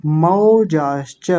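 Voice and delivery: मौजाश्च said slowly with a full मौ diphthong; careful teacher demo; slow syllables. Shown as a demonstration. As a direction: stretch out मौजाश्च, open the मौ. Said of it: "मौ बोलेंगे".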